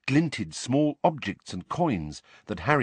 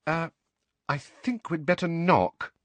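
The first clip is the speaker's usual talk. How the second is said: higher, hesitant suggestion; a little nervous; 8 semitones higher than usual. A narrator's reading. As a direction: suggest it with slight unease. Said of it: "now sounding a little nervous".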